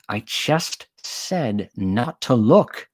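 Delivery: sharp slow hiss, a touch strained. Acted. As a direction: hiss it out slowly. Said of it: "the stranger hissed".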